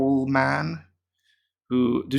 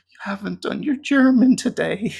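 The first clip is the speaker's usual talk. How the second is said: higher, louder guilt-trip voice with a laugh; mock Duo owl; 10 semitones higher, 5 dB louder than usual. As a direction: play the pleading app owl, laughing. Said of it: "dog eyes saying".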